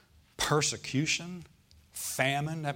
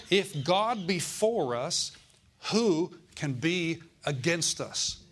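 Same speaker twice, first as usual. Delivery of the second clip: slow proclamation; 3 semitones higher, much slower than usual. Acted. Preacher proclaiming scripture.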